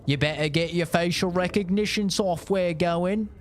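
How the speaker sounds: high, loud mocking British accent. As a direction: sneer like a smug Brit in a British accent. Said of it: "the Brits doing the Brit thing".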